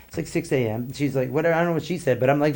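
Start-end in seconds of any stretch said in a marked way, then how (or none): none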